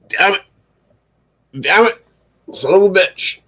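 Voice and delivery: high, strained curses at the broken setup; angry yelps at the broken camera; frustrated cursing. Real, not acted. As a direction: yelp out the swears in annoyance. Cursing at tech failure.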